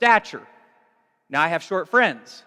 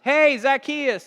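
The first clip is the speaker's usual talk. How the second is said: calling out; 6 semitones higher, 5 dB louder, slower than usual. Retold or imitated. Jesus calls up into tree.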